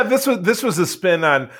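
normal talking voice

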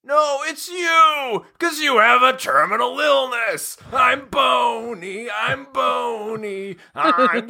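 very high, slow cartoon shout; Bony voice. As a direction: belt it high and drawn out. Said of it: "Dave, you're bony".

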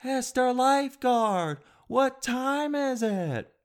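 put-on high, slow, sing-song kid calling out; kid's voice. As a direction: drag it out like a cheeky twelve-year-old. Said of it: "every five minutes they'll go".

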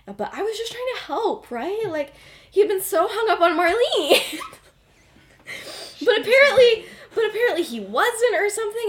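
high-pitched, hammed-up protest breaking into laughter; indignant self-defence. James defending his matchmaking to Remus, mock indignant.

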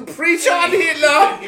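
high, loud mocking heckle; mock cheer. Heckling his friend's beer read with a loud jeer. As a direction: egg him on with a jeering shout.

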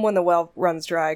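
usual speaking voice

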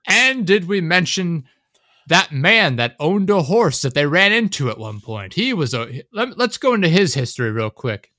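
exaggerated, swooping narration; mock rambling narrator. Parodies a rambling movie narrator.

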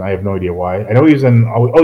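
ordinary talk, nothing marked

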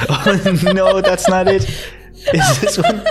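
high, laughing while talking. Real, not acted. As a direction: talk through the giggles. Laughing at the snake picture.

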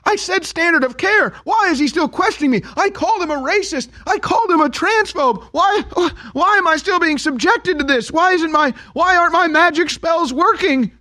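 very high, rising exasperated witch whine; a sorceress baffled her spells fail; confused witch. Retold or imitated. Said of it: "She's confused".

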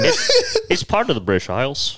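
ordinary delivery

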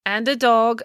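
mayor conceding the addition mid-speech; amending. A narrator's reading. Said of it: "amended the mayor".

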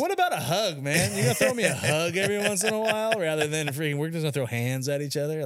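high, fast mocking voice; mock sarcastic plea. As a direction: needle him with a fake sweet plea. Mocking his dad in retold fight.

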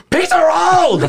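loud high yell of demand; drunk shout. Retold or imitated. Drunk shouting after sneaking in.

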